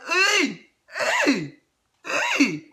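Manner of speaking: high, whiny fake-crying "Ý"; hammed-up crybaby squeal; mock whimper. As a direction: whimper in pretend distress. Mock reaction to PM insults.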